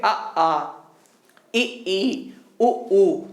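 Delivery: अ, आ, इ, ई, उ, ऊ said clearly for the class to echo; vowels to repeat. Shown as a demonstration. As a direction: model each vowel for students to copy. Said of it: "मेरे साथ बोलिए". (साथ बोलने के लिए साफ़ स्वर)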